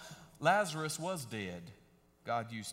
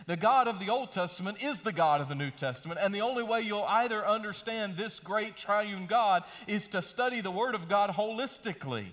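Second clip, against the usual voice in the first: rising preaching; 4 semitones higher, 4 dB louder than usual. Acted. Preaching at full force.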